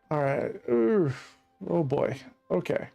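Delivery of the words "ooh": normal talking voice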